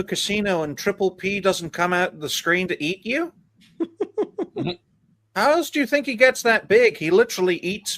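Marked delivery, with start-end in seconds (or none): 3.8-4.7 high-pitched giggle. Real, not acted. Laughing at own joke.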